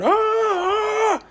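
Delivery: short high scream imitated; mum's scream imitated. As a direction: mimic a frightened mother shrieking. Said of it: "heard mum in the background screaming".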